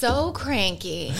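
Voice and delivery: slow, drawn-out mock whine; teasing drawl. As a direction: stretch it out to tease. Teasing partner about bad mood.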